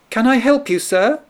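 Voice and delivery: very high, little mouse offering help; small mouse voice. A narrator's reading. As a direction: call up gently like a tiny mouse. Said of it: "the little mouse asked".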